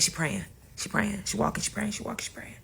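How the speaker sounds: hushed repetition dying away to near silence; fading murmur. Retold or imitated. Narrator acting out aunt walking and praying, fading murmur.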